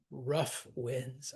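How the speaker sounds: "rough" and "winds" both stressed and drawn out; spondee. Shown as a demonstration. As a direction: give "rough" and "winds" equal weight. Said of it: "such a quintessential spondy".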